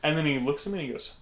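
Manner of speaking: normal talking voice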